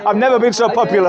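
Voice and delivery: said through amused laughter; amused laughing. Real, not acted. Laughing after vendors swarm him.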